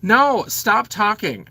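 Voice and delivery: exasperated command to a smart speaker; annoyed. Real, not acted. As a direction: cut the machine off, fed up. Snapping at Alexa mid-story.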